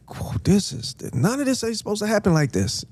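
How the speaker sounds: high, fast whispered alarm; panicked aside under the breath. Retold or imitated. Watching the fight unfold, a high fast alarmed whisper. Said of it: "And I'm just like".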